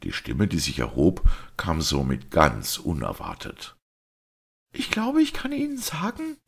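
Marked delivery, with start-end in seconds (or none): 4.7-6.4 put-on much higher young woman's voice, clear and trembling; a brave lady speaking up in public; the daughter voiced much higher, clear with a tremble. A narrator's reading. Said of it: "mit jener klaren, zitternden Stimme".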